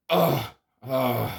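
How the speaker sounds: a couple of hammed-up pained ughs; half-whimpering ugh of disgust; mock anguished groan. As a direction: groan like it physically hurts to watch. Groaning at the terrible movie.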